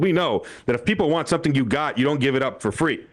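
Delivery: ordinary talk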